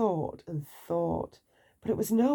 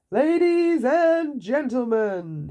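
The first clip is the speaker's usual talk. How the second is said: slow, higher, louder king's proclamation; grand royal address; 6 semitones higher, 5 dB louder, slower than usual. A narrator's reading. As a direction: announce it like a pompous king. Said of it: "he said to the crowd".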